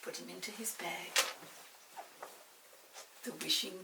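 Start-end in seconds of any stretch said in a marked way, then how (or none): none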